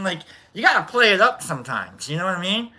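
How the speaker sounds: ordinary talk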